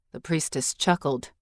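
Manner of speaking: ordinary delivery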